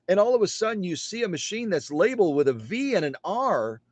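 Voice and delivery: usual speaking voice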